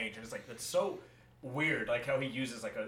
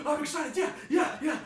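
high, gasping, eager yeahs; mock excited; 12 semitones higher, 5 dB louder than usual. Shown as a demonstration. Co-host says get excited for the mic test, mock excited. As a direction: put on a burst of excitement. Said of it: "Get excited".

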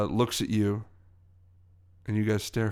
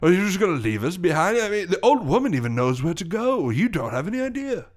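indignant NPC; 7 semitones higher, 4 dB louder than usual. Acted. GM voicing abandoned NPC.

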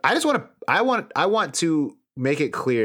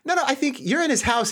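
normal talking voice

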